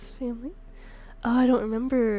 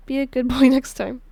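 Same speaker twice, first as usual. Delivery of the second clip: teasing laugh; 8 dB louder than usual. Acted. Teasing partner.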